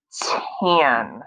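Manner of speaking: "Tan" whispered, no voice; whispered word. Shown as a demonstration. Teacher modeling the word for kids: whispered.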